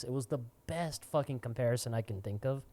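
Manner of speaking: normal talking voice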